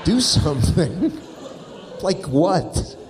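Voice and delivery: laughing disbelief. Real, not acted. Mocking her request.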